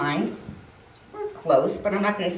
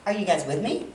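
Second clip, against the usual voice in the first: ordinary talk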